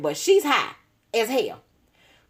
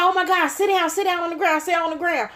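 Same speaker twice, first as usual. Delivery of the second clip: urgent man; 7 semitones higher than usual. Retold or imitated. Man ordering her down.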